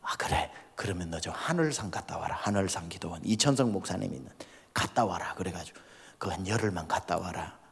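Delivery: quiet, gentle instruction; soft reply. Retold or imitated. Pastor gently sending his brother to the prayer house, quiet. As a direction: tell him kindly, in a low voice.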